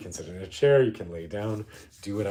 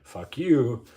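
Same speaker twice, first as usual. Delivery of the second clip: higher, loud defiant outburst; 7 semitones higher, 11 dB louder than usual. Acted. Defying homogeneity at Pride, loud and defiant. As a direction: throw it out loud and high, defiantly. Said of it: "this idea of saying".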